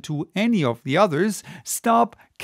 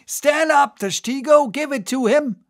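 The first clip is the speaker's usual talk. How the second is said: high, raised-voice command; high-pitched order across the boat; urgent cry; 10 semitones higher, 4 dB louder than usual. A narrator's reading. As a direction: call the harpooner to strike. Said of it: "cry was heard".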